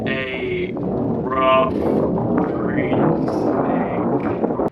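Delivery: slow, drawn-out whisper; slowed whisper effect. Acted. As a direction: stretch it out in a hushed whisper. Repeated slowly, whispered, edited.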